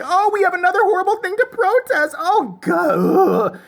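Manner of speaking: high, whiny mock protester voice; mock Westboro woman.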